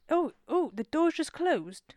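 startled, drawn-out realisation; surprised. Real, not acted. Co-host returns unexpectedly.

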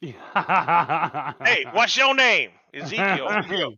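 high, loud put-on voices trading lines; hammed-up exchange, the last name drawn out slowly; mock dialogue with Ezekiel. Mocking Ezekiel to podcast friends, hammed-up loud put-on voice. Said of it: "I'm just like".

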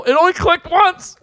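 high, loud panicky voice; panicky quote. Retold or imitated. Retold panic on a ride.

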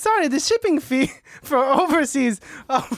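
high-pitched apologetic little secretary; put-on tiny high voice; Sashita. Secretary raises overseas shipping fee, high put-on voice.

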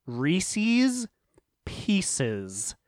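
Reese's as REE-seez, then Pieces as PEE-siz, slow; mixed pronunciation. Shown as a demonstration. Offering the real answer, Reese's -eez then Pieces -iz. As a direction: say Reese's with -eez, pause, drop to a normal Pieces. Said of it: "I think it's actually".

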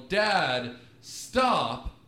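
loud, emphatic mock yell; imitated yell. Yelling into a room of adults, imitated yell. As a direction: call out each word separately to grab attention. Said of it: "you run into a room of adults and you yell".

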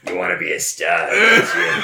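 loud, rising sleazy pimp pitch; smooth flashy pimp swagger. Acted. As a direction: coax like a slick pimp. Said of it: "my sleazy, gross pimp voice".

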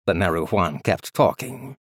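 ordinary delivery, nothing marked

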